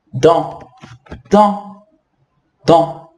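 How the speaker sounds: dent said as French "dã" with no final T. Shown as a demonstration. Teacher drilling dent without the T for students. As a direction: drop the T in dent, nasal vowel. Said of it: "Sem pronunciar o T".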